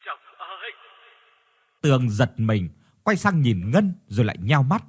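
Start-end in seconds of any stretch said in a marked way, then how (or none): 0.1-0.7 faint far-off ghostly call; eerie distant call. A narrator's reading. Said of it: "một giọng nói vang vọng".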